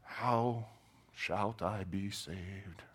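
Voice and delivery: hushed, slow, pompous "shalt" phrasing; mock scholarly whisper. Preacher imitates Nicodemus asking Jesus, hushed scholarly whisper. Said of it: "like, scholarly way".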